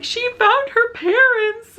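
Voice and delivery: high, slow, hammed-up sobbing voice; mock weepy wail. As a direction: wail it like a tearful child over a cartoon fish. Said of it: "And I'm like".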